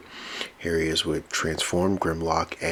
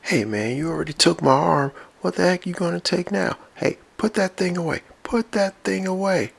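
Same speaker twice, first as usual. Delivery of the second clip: much higher, quick hammed-up worry; high nervous pleading; 13 semitones higher than usual. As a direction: beg him to put it down. Robot pleads after losing arm.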